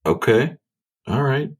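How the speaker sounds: ordinary talk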